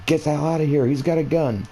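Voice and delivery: retold yell. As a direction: pitch jumps up to shout the warning. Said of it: "I yelled at my friend".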